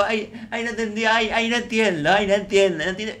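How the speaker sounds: aguda y aniñada, con ayes; imitando a Rosita. Retold or imitated. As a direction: imita a una chica despistada. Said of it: "habla así". (imitando a Rosita con voz aniñada)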